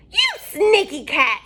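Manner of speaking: high, loud furious witch; angry witch caught out; witch yell. Acted. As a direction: yell at the cat in witch fury. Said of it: "yelled Bony Legs".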